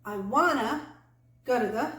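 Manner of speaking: "wanna" punched loud, "go to the" jumbled together; stress on WANNA. Shown as a demonstration. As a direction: hit "wanna" hard, then blur "go to the". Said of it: "we're really stressing the wanna".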